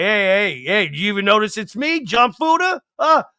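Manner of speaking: high, loud, swaggering tough-guy voice; a bald guy showing off his weight loss; proud John Fuda impression. John Fuda showing off his weight loss to the camera, high and loud. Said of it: "He's like".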